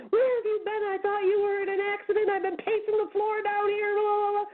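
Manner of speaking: imitating an anxious husband shouting; retold yelling. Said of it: "he started yelling at me".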